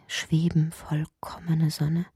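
drawn-out airy whisper; slow whisper. Acted. Ichi whispers of the perfect sun, slowly.

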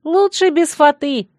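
high, drawn-out shout back; calling out. A narrator's reading. Bride calls back to her tormentors, shouting. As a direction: call it gleefully across a gap. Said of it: "крикнула я".

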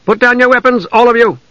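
high-pitched firm order; sharp command. A narrator's reading. Half-elf orders elves to disarm, sharp command. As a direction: call it out to everyone, commanding. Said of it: "the sharp command".

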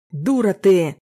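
loud angry yell; furious shout of contempt. Acted. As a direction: bark it at her full force. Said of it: "вдруг с новой силой заорал он".

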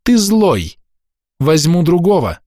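put-on high ringing child's accusation; strong ringing voice. A narrator's reading. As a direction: ring out the verdict clearly. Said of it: "сильным звенящим голосом".

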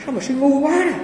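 high, mocking replay of the master's words; mock master's rebuke. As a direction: toss the insult back up high, teasingly. (nhại lại lời thầy chê, giọng cao, trêu chọc)

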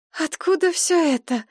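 high delighted question; thrilled at the sight of pastries; excited. Acted. Машка в восторге от выпечки. (радостное удивление)

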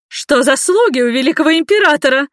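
high, loud piercing cry; piercing shout. A narrator's reading. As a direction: shriek at the guards in outrage. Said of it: "пронзительно кричала женщина".

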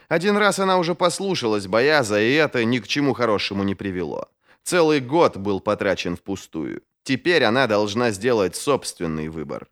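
ordinary delivery, nothing marked

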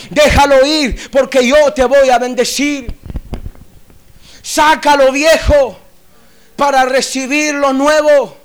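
forceful preacher with slow, stretched commands; emphatic preaching. Acted. Preacher urging believers to throw out the old, emphatic preaching. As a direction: hammer out each command, drawing out key words.

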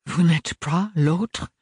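slow, rising astonished question; genuinely taken aback. A narrator's reading. Ambroise asking Ophélie, mildly astonished. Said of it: "s'étonna Ambroise".

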